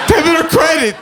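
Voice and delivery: the final shriek of total panic; shrieking breakdown. Retold or imitated. Crying cashier shrieks the question at the comic. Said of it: "started crying".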